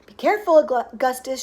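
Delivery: shouted warning. A narrator's reading. Father to son leaning over river, shouted warning. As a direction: warn him with a shout. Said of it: "shouted Mister Gloop".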